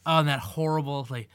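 ordinary talk

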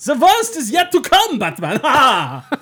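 put-on very high, loud German-accented proclamation; mock German villain. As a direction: shout it in hammed-up German, capped with a laugh. Trading villain line in German accent.

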